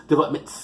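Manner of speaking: "development" ending in a long hissed S; final S exaggerated. Shown as a demonstration. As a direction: stretch an added S onto the end of "development". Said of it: "There's an S at the end".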